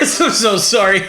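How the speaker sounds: apologising through laughter; laughing. Real, not acted. Laughing at own accent.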